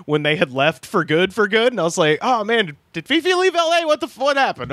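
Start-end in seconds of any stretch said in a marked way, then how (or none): 2.2-4.7 much higher, incredulous retelling; mock alarm. Host recalling seeing guest's posts from afar, mock alarm. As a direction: react as if stunned by a friend's departure. Retold alarm at friend leaving LA.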